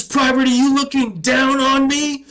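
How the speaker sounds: high, loud barking sergeant; drill sergeant yell. Retold or imitated. Drill sergeant yelling at a recruit who looked down. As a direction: bark it like a drill instructor.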